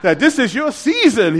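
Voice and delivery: hammed-up preacher voice; mock preacher. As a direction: preach it up like the comedian. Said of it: "like that".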